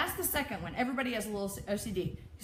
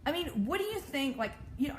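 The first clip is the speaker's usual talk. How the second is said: normal talking voice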